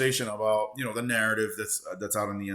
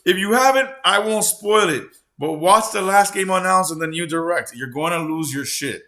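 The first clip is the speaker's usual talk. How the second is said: excited; 8 semitones higher, 11 dB louder than usual. Retold or imitated. Friend's excited message read out.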